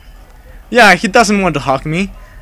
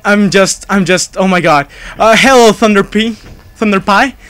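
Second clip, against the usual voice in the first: usual speaking voice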